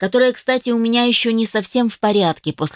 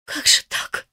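whispered through a sob; a maid's whispered sob of despair; sobbing whisper; slower than usual. A narrator's reading. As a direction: breathe it out while weeping. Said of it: "всхлипнула она".

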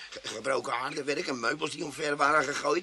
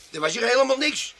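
loud, higher denial; protesting; 6 semitones higher, 7 dB louder than usual. Acted. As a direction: insist nothing was there. Tramp protests innocence.

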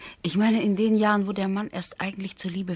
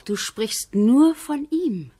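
a sudden higher, louder, slowed outburst of hurt; jealous reproach; 7 semitones higher, 8 dB louder, much slower than usual. Acted. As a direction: throw the accusation at him. Wife reproaches husband about friend. (eifersüchtiger, lauter Vorwurf)

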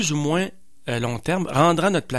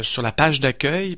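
normal talking voice

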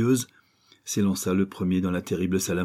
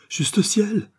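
loud, very high exclamation; aghast, pitched-up outburst; horrified shock; 13 semitones higher, 5 dB louder than usual. Acted. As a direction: recoil with a cry. Girl recoils at the confession.

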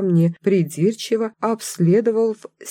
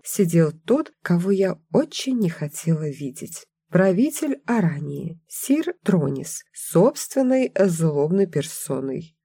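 ordinary delivery, nothing marked

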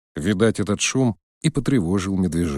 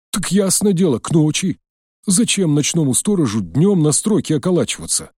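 put-on nervous old man; 7 semitones higher than usual. A narrator's reading. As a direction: babble like a scared old watchman.

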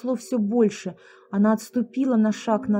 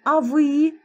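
sharp, loud and higher; sharp angry turn; 6 semitones higher, 6 dB louder than usual. A narrator's reading. Lera rounds on the guys, angry. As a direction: snap it at them angrily. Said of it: "резко развернулась она".

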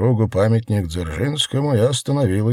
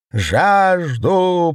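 wild cry; 10 semitones higher, 3 dB louder than usual. A narrator's reading. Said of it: "дикий крик Осипа".

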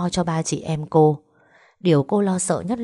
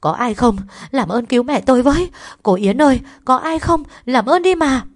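loud, high shouts for help; urgent cries climbing in pitch; sharp frantic calling; 8 semitones higher, 6 dB louder than usual. A narrator's reading. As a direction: call into the building, testy and desperate. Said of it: "vừa gọi vào bên trong vừa gắt gỏng".

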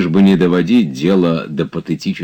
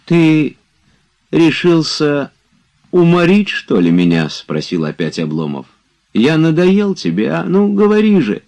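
higher, indignant master scolding his servant; reproachful master; 12 semitones higher, 5 dB louder than usual. A narrator's reading. Oblomov reproaching Zakhar over the move, indignant and hurt.

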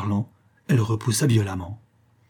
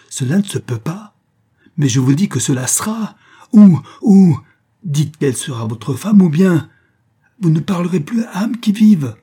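furious threat; 8 semitones higher, 8 dB louder than usual. Acted. Mother threatens count on cliff.

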